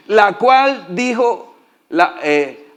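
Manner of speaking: usual speaking voice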